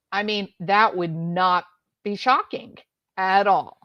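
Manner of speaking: ordinary talk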